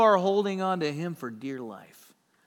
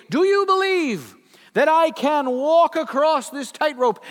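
higher and louder, a showman's cry; showman calling out; 9 semitones higher, 9 dB louder than usual. Retold or imitated. Blondin calling to the Niagara crowd, booming showman. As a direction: work the crowd like a barker.

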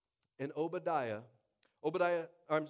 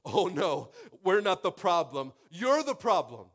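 loud, emphatic throwing back of blame; acted retort; 5 semitones higher, 12 dB louder, slower than usual. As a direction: fire the accusation back, rising in pitch. Church retorts to accusing government.

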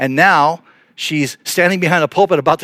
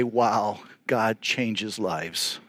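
soft, lower, very slow wonder; hushed awe; 4 semitones lower, 9 dB quieter, much slower than usual. Retold or imitated. Pastor remembering who changed his life, quietly awestruck. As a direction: say it slowly and softly, marvelling. What she taught him to say.

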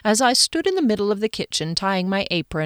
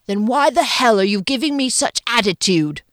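raised-pitch irate English chef; hammed-up bullying celebrity chef; Gordon Ramsay impression; 4 semitones higher, 4 dB louder than usual. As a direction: demand an answer in a bad English accent. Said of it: "do his Gordon Ramsay impression".

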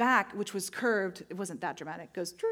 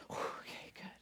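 whisper; 14 dB quieter than usual. Retold or imitated. Reluctant friend's whispered reply.

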